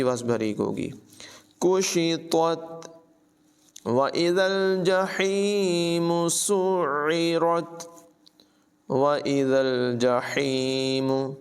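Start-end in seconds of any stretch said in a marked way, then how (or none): 1.6-11.3 slow chanted tajweed recitation; Quran recitation. Shown as a demonstration. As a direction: recite it melodically, drawing out the vowels. (धीमी, सुरीली क़ुरान तिलावत)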